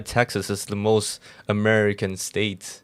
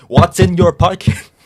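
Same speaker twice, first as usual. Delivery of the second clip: loud, high, irritated demand; annoyed security guard; 10 semitones higher, 16 dB louder than usual. Retold or imitated. Airport guard snapping at a confused traveler, loud and irritated. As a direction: bark the question like a fed-up guard. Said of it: "he gets so annoyed".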